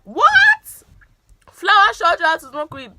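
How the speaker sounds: shrieked high what, then loud disbelief; mock shocked parent. Pretend parents gasping at the news, shrieked and loud. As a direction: play the outraged parent hamming up the shock.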